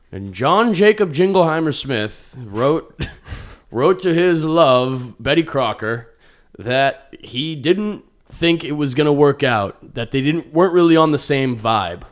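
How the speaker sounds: grand, drawn-out mock exhibit narration; mock letter reading. Comedian mocks museum Civil War letters, grandly drawn-out reading. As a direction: read it high, loud and slow, like a solemn old letter.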